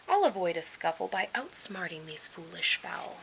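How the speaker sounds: sly unvoiced whisper. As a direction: whisper the plan like a scheming fox. Fox plots under his breath.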